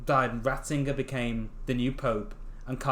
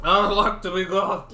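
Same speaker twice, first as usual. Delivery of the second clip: grand demand; 4 semitones higher, 7 dB louder, slower than usual. Acted. Acting wife's line.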